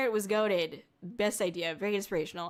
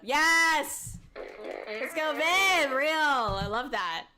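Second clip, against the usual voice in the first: higher, louder, slow cheer; excited cheer; 6 semitones higher, 4 dB louder, much slower than usual. Real, not acted. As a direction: cheer them on. Cheering a viewer on.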